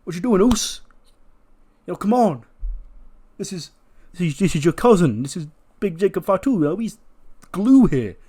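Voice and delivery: high, loud, urgent appeal; agitated pleading. Acted. Jimmy gets between Roman and his cousin, urgently pleading.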